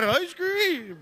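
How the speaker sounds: high, crying yell of ice cream; excited yell. Retold or imitated. Running after the ice cream truck, a high, excited yell.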